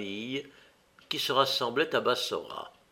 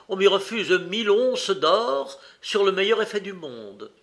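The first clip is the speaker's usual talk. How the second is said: indignant complaint, pitched up; angry; 6 semitones higher, 3 dB louder than usual. A narrator's reading. Egyptian complaining of a refused loan, indignant. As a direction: air a grievance in a higher voice. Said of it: "paraissait fort en colère".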